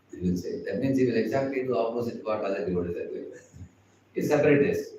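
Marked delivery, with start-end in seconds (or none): none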